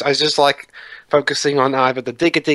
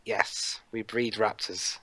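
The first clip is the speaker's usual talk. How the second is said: very soft, low, near-whispered reveal; hushed film quote; 4 semitones lower, 12 dB quieter, slower than usual. Acted. Film fan voices the park's admission about raptors, hushed. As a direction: drop to a hushed, lower voice.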